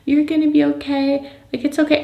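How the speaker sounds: put-on high, gentle little-girl comfort; child consoling. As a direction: soothe like a small child. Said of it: "she's consoling me".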